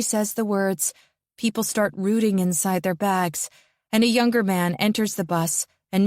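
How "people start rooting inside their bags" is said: ordinary delivery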